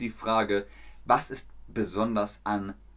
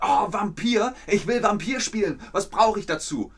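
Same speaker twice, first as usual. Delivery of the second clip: high, loud, eager beginner voice; eager player; 9 semitones higher, 4 dB louder than usual. Pretend. Teacher voicing an eager new player, high and loud. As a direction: play a keen newbie asking what he needs. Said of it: "Wenn ihr jetzt sagt".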